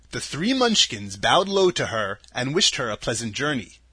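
ordinary delivery

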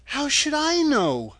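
put-on high, girlish question; little girl answering. A narrator's reading. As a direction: ask it in a high child's voice. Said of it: "answered Dorothy".

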